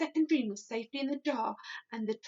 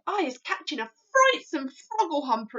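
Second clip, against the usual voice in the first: louder dismayed giant; distressed cry; 4 semitones higher, 7 dB louder than usual. A narrator's reading. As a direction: cry it out in disgust. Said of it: "he cried".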